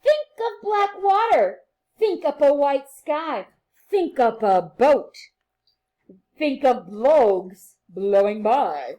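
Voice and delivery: bright, sing-song read-aloud for little ones; reading to children. A narrator's reading. Teacher reading a rhyming book to her class, sing-song and lively.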